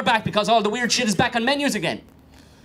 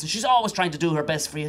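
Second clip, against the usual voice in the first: normal talking voice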